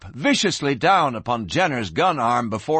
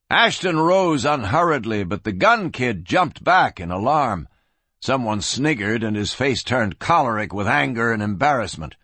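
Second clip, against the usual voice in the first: ordinary delivery, nothing marked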